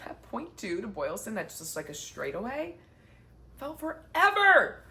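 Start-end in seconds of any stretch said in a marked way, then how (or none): 3.6-4.8 exasperated. Retold or imitated. Dragging final stretch of marathon.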